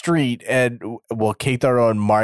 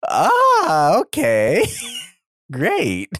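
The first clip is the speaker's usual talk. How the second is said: a sudden high, loud cry then deflating; mock dismay; 5 semitones higher, 4 dB louder, much slower than usual. Kaido hearing he needs two belts, mock dismayed cry. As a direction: yelp then resign yourself.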